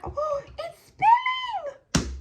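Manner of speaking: high-pitched startled outburst; startled cry. Real, not acted. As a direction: yelp in sudden surprise as things spin. Chair spins mid-story, she cries out.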